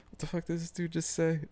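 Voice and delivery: high, fast, stunned disbelief; shocked outburst. Real, not acted. Streamer reacting to what some dude said, shocked. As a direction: blurt it out in shock.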